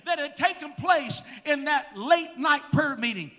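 loud, fervent preaching. Acted. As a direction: preach it with full fire. Preaching at full force.

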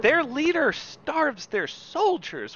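indignant. Real, not acted. Indignant rant.

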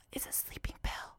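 very soft, voiceless secretive whisper; imitated whisper. Cellmate explaining blues to a new inmate, imitated whisper. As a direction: whisper it like a covert offer. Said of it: "thinks she's whispering".

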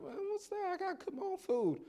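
high, soft squeamish squeal; hammed-up high-pitched reluctance; mock dismay. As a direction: act out doubts about a dodgy restaurant. Acting his doubtful reaction to restaurant.

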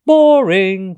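loud, high, drawn-out moan; cheeky sing-out of boredom; bored complaint. A narrator's reading. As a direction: call it out with open disdain. Pateri dismisses the roaring dinosaur.

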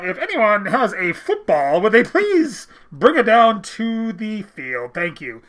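slow, loud vintage radio announcer; old-timey CBC announcer. Retold or imitated. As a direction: announce it like a 1950s stadium PA. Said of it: "like, old-timey nineteen fifty-six C B C announcer".

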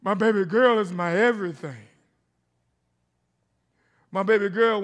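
loud and emphatic; loud preaching emphasis; preached. Real, not acted. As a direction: proclaim it with full force. Preaching about daughters.

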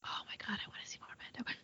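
very soft, voiceless whisper; excited whisper. Real, not acted. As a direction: whisper it like a fervent private wish. Excited aside about seeing Mendo.

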